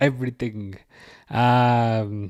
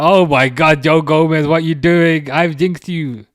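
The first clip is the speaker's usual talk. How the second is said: loud, higher cry of disbelief; exasperated live-match yell; alarmed outburst; 4 semitones higher, 7 dB louder than usual. Real, not acted. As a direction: shout at the player in dismay. Live alarm at player's mistake.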